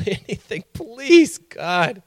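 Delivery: high-pitched pleading, acted out; desperate begging. Patient offered pain drugs, pleading desperately. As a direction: beg for relief as if at the limit.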